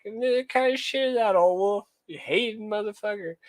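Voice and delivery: put-on playful high-pitched comeback to Old Wolf; mock indignant retort. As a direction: fire back at the hater, half joking. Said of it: "I was like".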